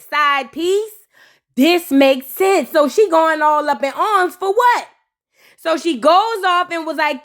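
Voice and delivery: usual speaking voice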